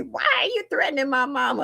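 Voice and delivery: high-pitched mock outrage; retold high, indignant challenge; indignant mother. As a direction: demand an answer as an offended mama, voice way up. Retelling mother's indignant phone call.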